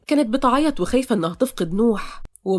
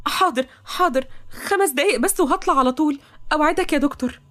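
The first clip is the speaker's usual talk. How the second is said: higher-pitched, pleading promise; eager young woman; 7 semitones higher than usual. Acted. Toqa promising the doctor a short visit, eager. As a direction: promise earnestly in a raised pitch.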